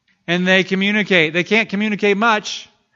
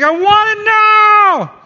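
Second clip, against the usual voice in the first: put-on high, loud, slow wail of a hungry baby; baby's demanding scream; 11 dB louder, much slower than usual. Preacher mimics a baby screaming for milk, loud wail. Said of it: "We're like".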